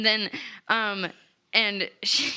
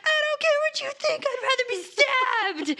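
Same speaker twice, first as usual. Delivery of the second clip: much higher, fast and defiant; defiant high retort; 14 semitones higher than usual. Retold or imitated. Lila rejecting the party's warning, high and defiant. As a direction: toss it off dramatically.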